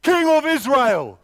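loud, slow outburst imitating the crowd; impassioned preaching. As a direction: cry it out in indignant disbelief. Preacher contrasts the crowd's cries.

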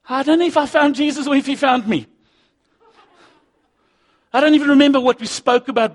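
high, loud, excited telling; preacher reliving his rush to find church; impassioned preaching. Acted. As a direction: let the excitement of the encounter pour out.

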